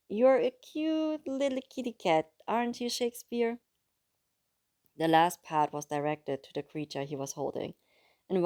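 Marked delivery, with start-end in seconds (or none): 0.1-3.6 put-on cooing baby talk. A narrator's reading. Luca talking sweetly to his new pet, cooing baby talk. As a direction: coo at the little creature.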